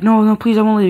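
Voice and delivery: high, pleading refusal to leave dad. Retold or imitated. Child begging his dad not to make him leave, pleading. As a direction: beg to stay, retold from memory. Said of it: "I'm like".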